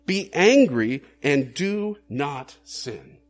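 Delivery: slow and loud, every word of "Be angry and do not sin" drawn out with weight on angry; solemn scripture quote. Shown as a demonstration. Preacher quoting Ephesians to the church, slow and loud. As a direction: read the verse slowly, leaning hard on "angry". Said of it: "In Ephesians four".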